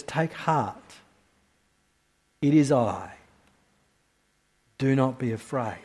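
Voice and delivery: put-on calm them like a frightened child; gentle reassurance. Quoting Jesus again.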